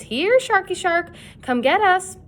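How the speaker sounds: high, loud mocking call. A narrator's reading. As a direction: call through cupped hands. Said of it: "called through cupped hands".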